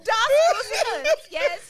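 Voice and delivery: high, loud mock German accent; overdone German accent; fake German accent. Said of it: "Like, you just put on an accent".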